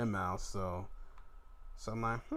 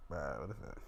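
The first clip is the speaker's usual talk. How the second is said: low deadpan; 11 semitones lower, 12 dB quieter, faster than usual. Retold or imitated. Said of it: "I'm like".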